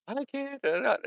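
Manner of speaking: high-pitched imitation of a whiner; mock complaint. Host mimics a reluctant beginner, high mock complaint. Mimics people who won't try drawing.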